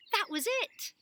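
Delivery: put-on thrilled girl solving the puzzle; eureka. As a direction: burst out with the answer. Alma's sudden realization.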